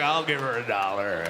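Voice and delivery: low creepy mutter; sleazy guy. Retold or imitated. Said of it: "He's like".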